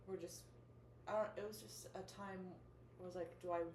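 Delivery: ordinary delivery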